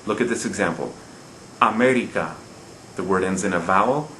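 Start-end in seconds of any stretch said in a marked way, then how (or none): 1.6-2.3 aMErica, weight on ME; third-to-last syllable stress. Shown as a demonstration. Teacher showing a rule-breaking word, America stressed on ME. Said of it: "We stress the third to last".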